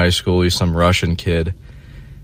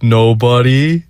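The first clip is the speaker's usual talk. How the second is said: louder rising "Nobody"; mock innocent denial; 4 semitones higher, 4 dB louder, slower than usual. Lying to the stripper, mock innocent rise. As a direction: play dumb, pitch it up. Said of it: "I'm like".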